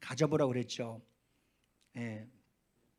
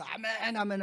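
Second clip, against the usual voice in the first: high, drawn-out amen; imitated fervent amen; 7 semitones higher, slower than usual. Elder in the front row at church, imitated fervent amen. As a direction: mimic a zealous worshipper's long amen. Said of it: "찬송도 크게 하고 박수치고".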